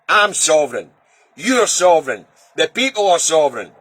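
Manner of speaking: ordinary talk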